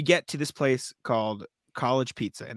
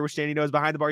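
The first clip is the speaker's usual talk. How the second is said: normal talking voice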